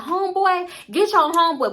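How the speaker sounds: high, loud call to a friend; Camille calling out. Retold or imitated. Camille yelling at Tammy to control her friend, high and loud. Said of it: "So Camille was like".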